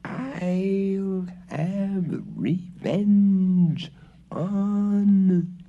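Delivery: high, very slow eerie ghost voice; ghostly threat. A narrator's reading. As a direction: drag out the threat menacingly.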